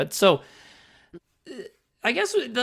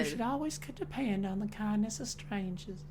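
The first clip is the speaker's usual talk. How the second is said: Southern belle; 5 semitones higher, 10 dB quieter than usual. Acted. Showing off a stage character, Southern belle accent. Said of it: "play a character like that".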